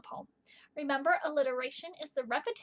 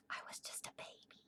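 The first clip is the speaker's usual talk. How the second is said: soft joking whisper; whispered aside; 20 dB quieter than usual. Real, not acted. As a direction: drop to a secret whisper.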